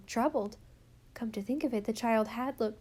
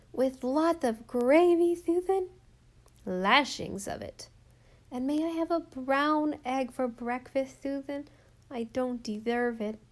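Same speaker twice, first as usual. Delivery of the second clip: put-on a small girl wheedling, higher, with a lisp; hopeful little girl; 4 semitones higher, 3 dB louder than usual. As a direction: coax Susan like a sleepy child. Rilla asks Susan for treats.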